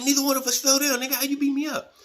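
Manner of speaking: imitating the big guy's high, loud disbelief; mock disbelief. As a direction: throw the question back at him in a high, loud voice. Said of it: "He's like".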